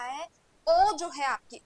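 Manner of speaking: the ओ held long and slow; drawn-out O. Shown as a demonstration. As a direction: stretch the ओ like in Om chanting. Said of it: "उसको आप लंबा खींचते हैं". (ओ को लंबा खींचकर)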